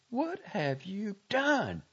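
high, slow, anguished demand; rising accusation. A narrator's reading. Girl confronting the doctor over her dead love, anguished. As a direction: raise the voice and draw out the accusation. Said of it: "Her voice rose slightly until it shook".

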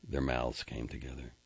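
ordinary delivery, nothing marked

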